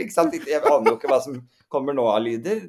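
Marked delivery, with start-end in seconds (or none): 0.0-0.4 excited. Real, not acted. Punchline to listener.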